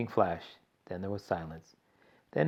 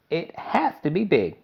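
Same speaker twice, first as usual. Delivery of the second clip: higher, slow eccentric chocolatier; Mister Wonka; 7 semitones higher, 6 dB louder than usual. A narrator's reading. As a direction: drawl it out like a showman. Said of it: "Mister Wonka explained".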